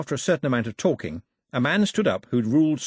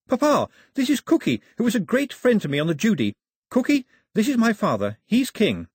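bright young Daphne, voiced higher; eager introductions, much higher; excited girl; 11 semitones higher, 4 dB louder than usual. A narrator's reading. Daphne introducing Cookie to her father, eager and higher.

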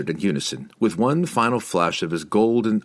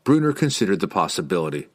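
ordinary delivery, nothing marked

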